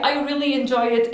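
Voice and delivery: ordinary delivery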